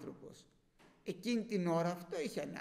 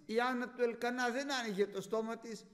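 ordinary delivery

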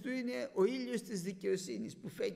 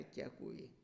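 ordinary talk, nothing marked

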